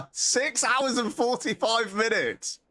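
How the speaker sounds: high, drawn-out incredulous complaint; high, exasperated mock wail; self-mocking exasperation. Real, not acted. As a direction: count up your wasted time in high disbelief. Laughing, tallies wasted hours.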